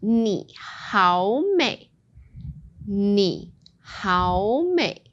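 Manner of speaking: very slow, careful 你好美; slow teaching model; slow demo. Shown as a demonstration. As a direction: stretch out 你好美 for the learner to copy. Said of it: "I've got another one".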